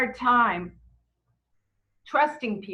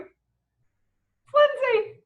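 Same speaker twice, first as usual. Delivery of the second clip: high, loud, drawn-out introduction; mock gushing reply; 14 semitones higher, 5 dB louder, much slower than usual. Stranger introducing herself back, hammed-up delight. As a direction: squeal the name slowly, hammed-up.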